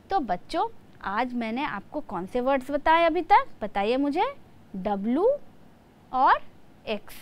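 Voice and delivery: usual speaking voice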